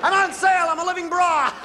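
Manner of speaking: loud, high playful yell; mock shout of a goofing kid; kid shouting from clothes rack. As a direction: jump out and shout it to scare the shoppers.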